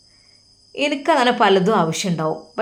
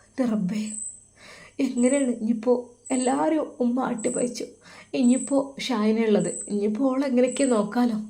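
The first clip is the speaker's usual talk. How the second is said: tearful inner worry; 2 semitones higher, 5 dB quieter than usual. Acted. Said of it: "കണ്ണീരോടെ".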